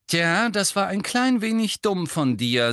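high, loud angry rebuke; heated telling-off; zornig. A narrator's reading. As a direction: snap at him indignantly. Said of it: "sagte Ginny zornig".

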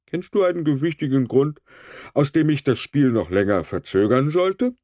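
high, lofty challenge; pompous referee. A narrator's reading. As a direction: ask it with puffed-up authority.

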